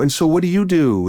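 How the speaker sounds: high, oblivious friendly question; imitating a chirpy polite inquiry; retold cheery small talk. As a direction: pitch it up like naive party chat. Obliviously quizzing the network president.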